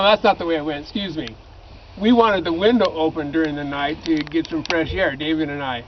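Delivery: ordinary talk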